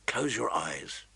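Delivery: soft, low, gentle voice. Acted. Son gently easing his exhausted mother. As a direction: soothe her toward sleep. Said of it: "he said gently".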